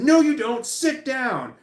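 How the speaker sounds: sharp, high, loud parental no; angry refusal. Retold or imitated. Bark it down high and loud. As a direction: parent denying kid the bathroom, high angry shout.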